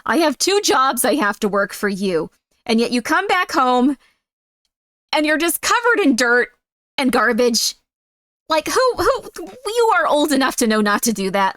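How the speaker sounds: acted exasperated mother's rant; overworked mom losing her patience; angry mom. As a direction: scold your child at wit's end. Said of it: "Why would you want one that's just like".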